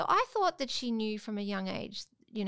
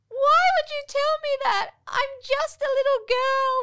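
put-on crying child; 11 dB louder than usual. Girl in tears at mum for confessing, loud high wail. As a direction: wail it like a heartbroken little girl. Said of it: "She burst into tears".

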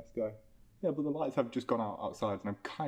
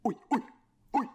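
loud, high mock yell of a mugger; imitating the mugger's shouted oi; 5 semitones higher, 3 dB louder than usual. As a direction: bark it like a street mugger demanding the phone. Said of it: "they just went".

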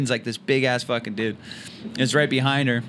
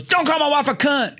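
high-pitched imitated angry yell; explosive mock bellow, pitched way up; enraged shout; 3 dB louder than usual. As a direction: yell it like a furious husband. Imitating enraged husband throwing drink.

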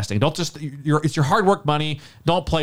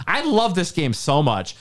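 normal talking voice

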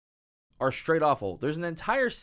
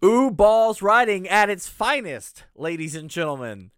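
sarcastic showman; 4 semitones higher, 8 dB louder than usual. Acted. Host mockingly presents the director to listeners, loud showman. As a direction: hype the bad director like a ringmaster.

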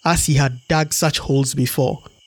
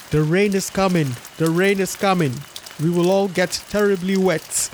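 higher, urgent cry of pretend panic; fake alarm; 5 semitones higher than usual. Anansi tricks the hornets into the gourd with fake alarm. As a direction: call it out as if the storm is truly coming.

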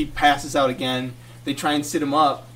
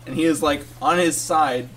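normal talking voice

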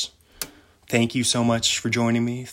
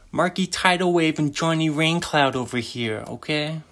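higher-pitched mock Italian mobster; 5 semitones higher than usual. Mobster threatening a visit to his friend Johnny Raincloud, mock Italian accent. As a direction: improvise a hammed-up Italian mobster warning. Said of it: "that's my Italian".

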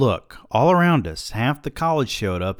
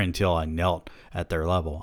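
ordinary delivery

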